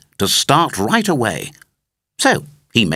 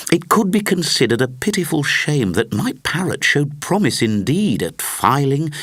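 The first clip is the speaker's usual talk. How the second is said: plain reading voice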